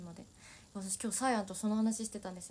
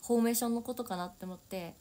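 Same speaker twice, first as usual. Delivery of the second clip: ordinary talk, nothing marked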